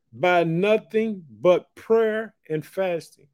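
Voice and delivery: high, slow preaching read; preaching scripture. Acted. Preacher tells the church the healing, high and slow. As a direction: carry the story with fervour.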